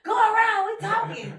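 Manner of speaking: high, loud shout; yelling at cars. Retold or imitated. In the parking lot, shouting at drivers to go around them. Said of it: "almost cussing people out".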